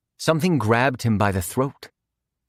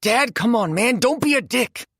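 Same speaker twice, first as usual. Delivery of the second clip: desperate plea; 11 semitones higher than usual. Acted. Quinn pleading with lighter.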